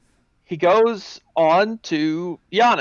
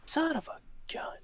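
whispered curse under breath; hushed, frustrated whisper; 13 semitones higher, 14 dB quieter than usual. Real, not acted. Muttered curse at failed search.

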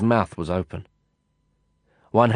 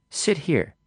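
commanding; 7 semitones higher than usual. A narrator's reading. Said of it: "she commanded".

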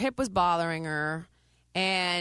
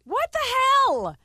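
loud, high, slow exasperated cry; a real cry of disbelief, drawn out slow; exasperated outburst; 8 dB louder, slower than usual. Real, not acted. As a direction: throw up your hands at the hijacked segment. Exasperated that the segment was hijacked.

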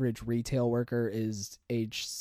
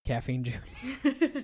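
mocking repeat; 6 semitones higher, much slower than usual. Mocking co-host's show name.

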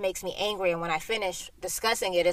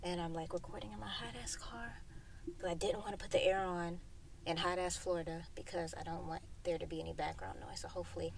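whispering; 12 dB quieter than usual. Real, not acted. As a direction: whisper it, wary of the van outside. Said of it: "sorry, y'all, there's a van".

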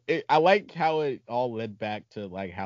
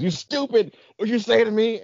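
janitor yelling; 11 semitones higher, 5 dB louder than usual. Retold or imitated. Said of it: "I like how he goes".

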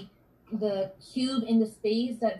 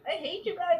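mock the gushing teen once more; teenager voice; 9 semitones higher than usual. Birthday girl thanking her family, high mock teen whine. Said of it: "I used to talk like that as a teenager".

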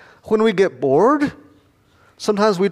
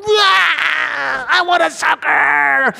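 fake crying wail; 10 semitones higher, much slower than usual. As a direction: wail it like a toddler denied candy. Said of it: "And she cries".

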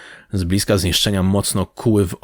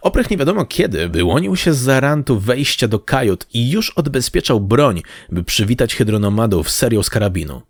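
ordinary delivery

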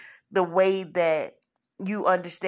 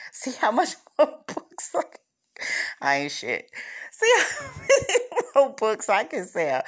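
higher, laughing through the words; laughing joke; 7 semitones higher than usual. Real, not acted. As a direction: chuckle while teasing herself. Joking about selling more books.

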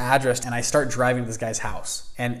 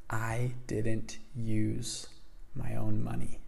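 slow, softer, leaning in to share; confiding secret; 2 semitones lower, 9 dB quieter, much slower than usual. Retold or imitated. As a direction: let a secret out slowly and quietly. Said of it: "he kinda leans in".

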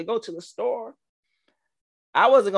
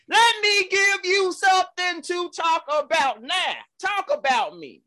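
preaching; 12 semitones higher than usual. Acted. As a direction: preach it up to the rafters. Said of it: "Where's the organ?".

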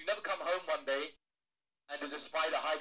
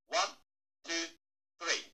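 ordinary talk, nothing marked

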